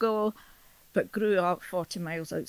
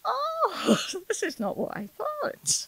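surprised realisation; 13 semitones higher than usual. Retold or imitated. Retells her surprise on reading Burns.

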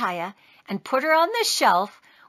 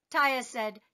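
usual speaking voice

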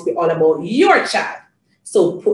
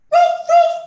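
high, loud little woof-woof; dog bark; 5 dB louder than usual. Shown as a demonstration. Telling Janie what the doggy says, high barking. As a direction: bark like an excited small dog. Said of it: "The doggy says".

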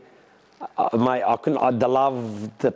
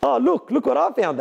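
excited discovery; 13 semitones higher than usual. Retold or imitated. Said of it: "he goes".

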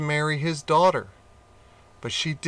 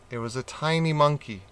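ordinary delivery, nothing marked

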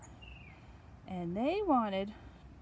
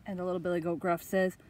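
plain reading voice

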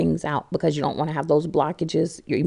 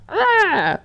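frustrated groan; 4 dB louder than usual. Retold or imitated. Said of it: "and I was like".

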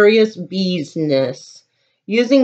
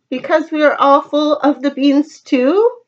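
ordinary delivery, nothing marked

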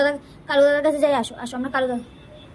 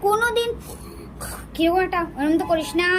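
ordinary talk

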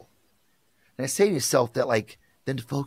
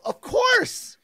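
emphatic; 4 dB louder than usual. Retold or imitated. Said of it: "And I go".

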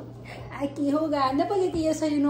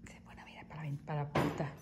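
whispering; 10 semitones lower, 18 dB quieter than usual. Real, not acted. Whispering about dog.

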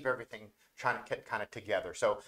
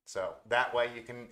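ordinary talk, nothing marked

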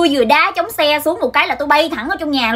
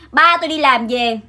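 ordinary talk

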